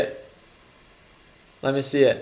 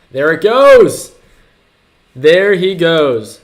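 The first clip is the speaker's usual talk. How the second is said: loud, high, slow cheer; excited shout; 5 semitones higher, 10 dB louder, slower than usual. Real, not acted. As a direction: cheer as he finally comes on. The Fed chair appears on screen.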